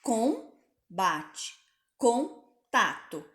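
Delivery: com, bate, com, tato split with pauses between the syllables; sílabas separadas. Shown as a demonstration. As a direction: break each word into its syllables for the class. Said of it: "eu quero que vocês prestem atenção".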